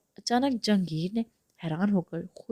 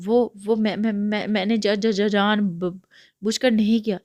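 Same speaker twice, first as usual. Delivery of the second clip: frightened stutter, louder, tripping on words; stammering fear; 2 semitones higher, 6 dB louder than usual. Acted. Iram stammers in fear to angry brother. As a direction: stammer out a panicked excuse. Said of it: "گھبرا کر کہا".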